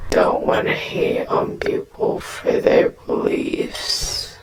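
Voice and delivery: a low, slow, eerie whisper; low creepy whisper, drawn out. Pretend. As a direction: breathe it out low and creepy, slowly. Repeats line as spooky whisper.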